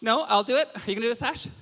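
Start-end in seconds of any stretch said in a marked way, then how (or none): 1.5-1.5 loud, higher call for applause; hammed-up show host; MC hype. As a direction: work the crowd like an announcer.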